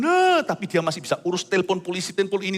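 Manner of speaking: ordinary delivery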